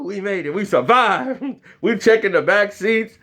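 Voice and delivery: ordinary talk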